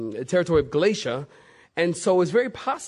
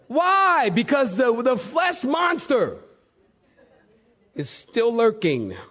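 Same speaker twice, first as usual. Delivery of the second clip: ordinary talk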